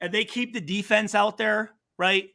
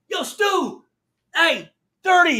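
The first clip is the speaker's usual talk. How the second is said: calling out; 9 semitones higher, 6 dB louder, slower than usual. Retold or imitated. Opponent calls out across field.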